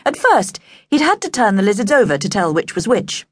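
ordinary delivery, nothing marked

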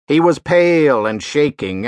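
ordinary delivery

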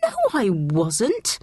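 indignant, drawn-out denial. A narrator's reading. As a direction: protest at being lied about. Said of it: "Abby said indignantly".

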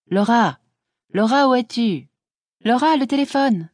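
high-pitched call from the house. A narrator's reading. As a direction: call someone in from the garden. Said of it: "cria de la maison une voix".

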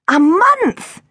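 high, disbelieving repeat; can't believe what she just heard; shocked echo. Acted. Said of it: "echoed Tilly".

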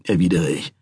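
usual speaking voice

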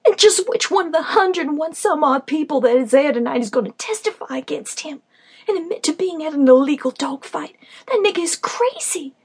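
high, loud disbelieving outburst; are you out of your mind; incredulous protest. Acted. Battered friend protests in disbelief.

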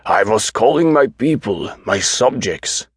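high-pitched, insistent patient voice; deluded self-styled king. A narrator's reading. Patient explaining himself to doctor as king, insistent. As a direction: insist on it, then slow down grandly.

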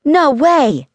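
put-on high, loud kid exclamation; astonished child's cry; gasped shock. A narrator's reading. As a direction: blurt it out high, in disbelief. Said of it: "Janie gasped".

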